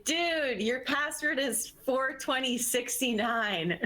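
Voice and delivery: put-on high-pitched, loose drunken blurting; pretend drunk. Trespasser swaying before the guard, pretending to be drunk. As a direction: play it tipsy, pitched well up. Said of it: "pretend to be drunk".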